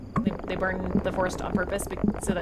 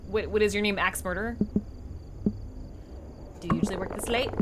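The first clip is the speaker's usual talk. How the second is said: ordinary delivery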